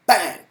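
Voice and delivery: a sudden very loud high "bang!"; imitated gunshot. Narrator voicing the dealer shooting mid-sentence, loud gunshot noise. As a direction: blurt the shot out sharp.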